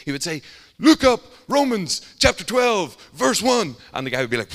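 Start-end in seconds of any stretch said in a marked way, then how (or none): 0.8-3.8 high, loud bellowed command; mock bellow. Street preacher orders his Bible reader to look up verses, bellowing. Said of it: "he would just bellow".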